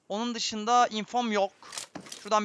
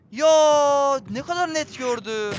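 slow, higher, louder disbelief; stretched-out groan of disbelief; stunned disbelief; 6 semitones higher, 5 dB louder, much slower than usual. Real, not acted. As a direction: drag it out, can't believe it. Just killed, disbelief.

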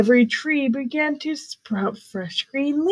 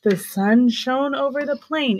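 ordinary delivery, nothing marked

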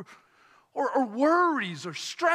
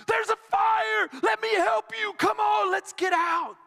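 urgent yell; 9 semitones higher than usual. Pretend. Passer-by to family in burning house, urgent high yell. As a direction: yell to get them out of the fire. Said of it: "yell at the top of your lungs".

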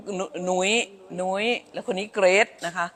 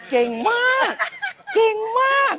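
much higher, bright praise for the little ones. Real, not acted. School director praising the grandchildren for being brave, high cooing praise. Praising children who won't cry.

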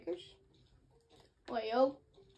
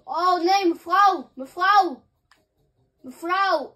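calling out; 4 semitones higher, 11 dB louder, slower than usual. Acted. Warning a woman about a car.

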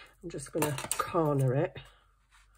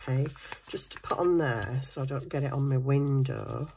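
normal talking voice

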